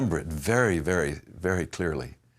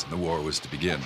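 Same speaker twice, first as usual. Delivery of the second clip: ordinary talk, nothing marked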